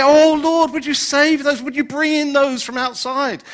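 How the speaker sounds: mock-earnest prayer, high and loud; imitated pleading prayer. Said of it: "Now we say".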